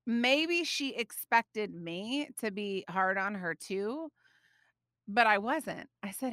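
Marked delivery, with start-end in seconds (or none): none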